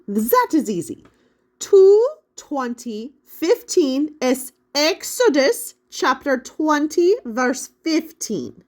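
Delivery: put-on high, loud, slow German-accented explanation; helpful Gutenberg, German accent. A narrator's reading. Gutenberg explains the call number to Miguel, German accent. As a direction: spell it out for the kids in a German accent.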